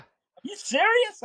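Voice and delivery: slow, high-pitched incredulous question; imitated disbelief. People react to the grocery-store beer story, mock incredulous. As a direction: draw it out high, as if you can't believe it.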